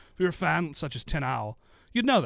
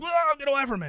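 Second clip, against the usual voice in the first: high yelp pulling away; startled recoil; 14 semitones higher, 5 dB louder, faster than usual. Retold or imitated. Red Cross workers recoiling from a hug, startled high yelp. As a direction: jump back, shrieking get away. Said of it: "they were like".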